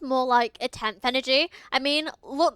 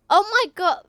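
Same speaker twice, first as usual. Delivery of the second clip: higher, louder exclaim of surprise; real surprise; 6 semitones higher, 7 dB louder than usual. Real, not acted. Streamer reacting to the game's answer, surprised and louder. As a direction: caught off guard, blurting out. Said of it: "reacting to game".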